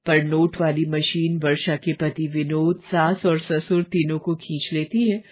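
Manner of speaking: usual speaking voice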